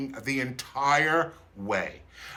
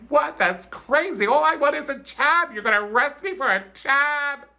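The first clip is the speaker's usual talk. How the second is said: high, incredulous outrage; indignant disbelief; 3 dB louder than usual. Retold or imitated. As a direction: squeal it like a teen who can't believe it. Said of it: "I just was like".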